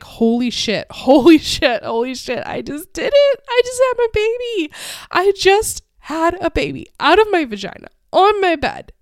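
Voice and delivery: loud, high, giddy disbelief; re-enacted burst of joyful shock; amazed elation. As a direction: exclaim it, stunned at your own triumph. Retelling amazement right after giving birth.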